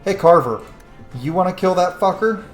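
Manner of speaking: normal talking voice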